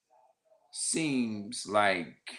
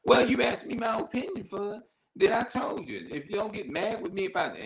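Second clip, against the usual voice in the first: pitched-up imitation of a defensive relative; high mock family voice; 12 semitones higher than usual. Relative snapping back at the hurt asker, high and defensive. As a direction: play the family member, high and indignant.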